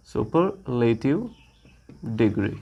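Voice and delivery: Superlative stretched out very slowly, then degree low and soft; slow dictation. Shown as a demonstration. Teacher slowly dictating superlative degree to students, slow and clear. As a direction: spell out superlative slowly, each sound clear.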